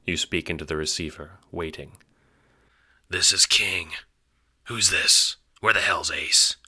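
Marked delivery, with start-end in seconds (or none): 3.1-6.6 suave irritated. Acted. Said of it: "A suave, irritated voice".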